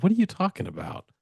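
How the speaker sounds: high, incredulous exasperation; exasperated. Retold or imitated. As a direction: act out being fed up with a confusing friend. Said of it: "it started to make me mad".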